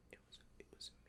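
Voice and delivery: eerie hushed whisper; whispered confession. Pretend. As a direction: breathe it like a creepy secret. Spooky confession joke.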